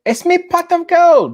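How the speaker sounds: high, loud Irish leprechaun voice. Acted. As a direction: squeal it like a greedy leprechaun. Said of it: "leprechauns, finding the gold".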